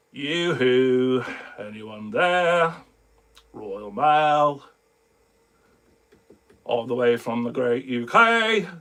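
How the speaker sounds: low, drawn-out reluctant read; not having it. Retold or imitated. Friend coaxed into a tiny soundbite, not having it. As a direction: imitate a friend reading it with no enthusiasm, low. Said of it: "like, not having it".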